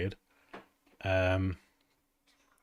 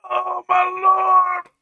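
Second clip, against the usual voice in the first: shocked exclamation; 13 dB louder, much slower than usual. Real, not acted. Reacting to the image.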